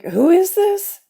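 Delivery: high, rising, puzzled voice; bewildered question. Retold or imitated. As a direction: pitch leaps up in mock confusion. Confused by the strange caller.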